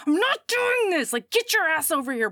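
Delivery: high, hurried acted demand; indignant ordering. Heroine orders Brian to send visitor away, indignant. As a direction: rattle off orders as the fed-up heroine.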